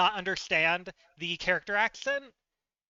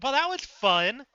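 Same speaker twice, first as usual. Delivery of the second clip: higher and louder, dry sarcastic cheer; 6 semitones higher, 5 dB louder than usual. Retold or imitated. Reacting to the deleted server, sarcastic. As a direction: say it bright, mean the opposite. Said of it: "And so I'm like".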